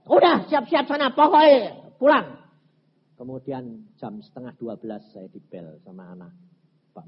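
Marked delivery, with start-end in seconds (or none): 0.1-2.4 loud, high impatient command; shouted order. Retold or imitated. Pastor sends the family home to prepare, shouted order. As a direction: bellow it out, stretching the last word. Orders them to get ready and leave.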